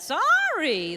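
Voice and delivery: "Sorry" swooping loud and high in the middle, then falling; drawn-out, insincere "Sorry" with a high peak; rising mid-word. Shown as a demonstration. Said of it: "when it goes up in the middle like that".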